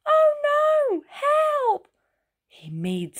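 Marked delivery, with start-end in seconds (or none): 0.1-1.9 high, panicked yell; yelling for help. A narrator's reading. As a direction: scream for help as the crow carries you off. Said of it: "He yelled".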